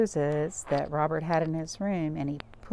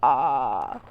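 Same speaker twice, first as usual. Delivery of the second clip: Robert saying ah; 6 semitones lower, 8 dB louder than usual. A narrator's reading. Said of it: "said Robert".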